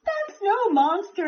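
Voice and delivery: yelled. A narrator's reading. Squirrel correcting Dragon from the treetop, high yell. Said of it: "yelled the squirrel".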